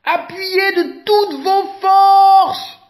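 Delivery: very high, loud, slow plea; drawn-out desperate plea; pleading. A narrator's reading. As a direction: beg with everything left. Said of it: "supplie Éléphant".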